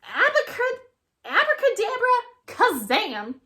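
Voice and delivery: very high, loud magic chant; spell incantation. Acted. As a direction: cast the spell with flourish. Witch casting a spell.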